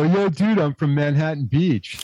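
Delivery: hammed-up beach bro voice; laid-back California dude. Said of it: "He's gonna go".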